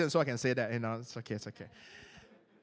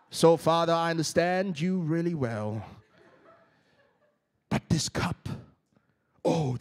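put-on butchered English accent; 6 semitones lower than usual. Preacher imitating Jesus praying about the cup, badly faked English accent. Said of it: "I just butchered the whole English, you know, accent".

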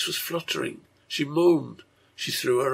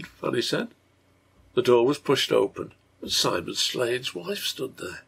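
ordinary delivery, nothing marked

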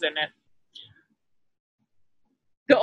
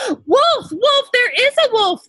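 much higher, louder panicked cry; terrified boy crying out; 14 semitones higher, 5 dB louder than usual. A narrator's reading. Boy scrambling up a tree, crying out in panic. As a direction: scream for help in terror. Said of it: "the boy cried as".

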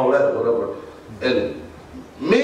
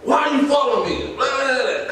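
mock angry shout; 9 semitones higher than usual. Imitating the driver yelling at him, mock shout.

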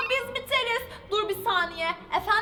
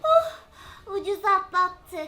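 ordinary delivery

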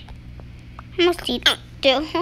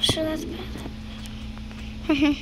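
whisper; 9 dB quieter, much slower than usual. Real, not acted. Soft whisper aside.

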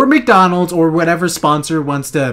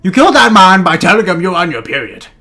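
put-on British accent; 2 semitones higher, 4 dB louder than usual. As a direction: scold in a hammed-up British accent. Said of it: "My British accent".

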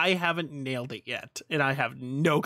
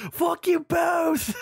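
high, slow hammed-up yell; imitating a friend's tirade; mock furious shout; 4 dB louder, much slower than usual. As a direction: shout it back at both of them. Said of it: "your tirade kills me".

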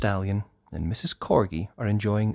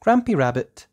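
ordinary delivery

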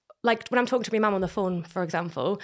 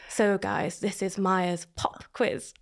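slow, lower, softer host announcement; quiz host; 4 semitones lower, 6 dB quieter, much slower than usual. Pretend. Dreamed-of moment introducing the quiz, slow host voice. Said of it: "a quiz host".

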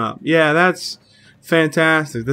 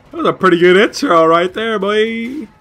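high, louder, cracking excitement; 7 semitones higher, 5 dB louder than usual. Real, not acted. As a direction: let the voice crack upward as you cheer. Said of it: "Sorry if my voice kinda cracks".

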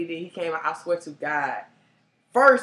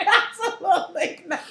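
high, loud laughing no; firm but giggling refusal; 13 semitones higher, 8 dB louder than usual. Retold or imitated. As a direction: refuse him, half laughing. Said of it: "I said".

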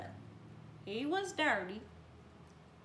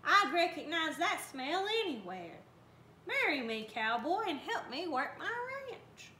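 excited; 6 semitones higher than usual. Acted. Lurleen proposes to Bubba.

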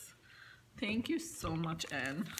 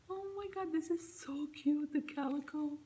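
higher, breathy whisper of awe; 8 semitones higher than usual. Real, not acted. Awed by gift.

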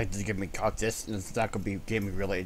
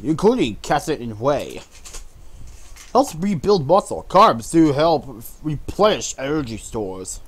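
reading the label; 3 semitones higher, 6 dB louder than usual. Acted. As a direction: read it out like an ad. Reads the label aloud.

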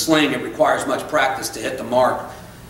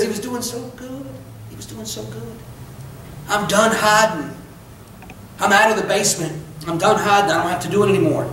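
mother's voice breaking in a hushed, high sob; grieving mother, soft and high; 5 semitones higher than usual. Retold or imitated. A mother quoting her dead son, hushed and high-pitched. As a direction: speak as a weeping mom, barely above a breath. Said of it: "the mom looks at you and says".

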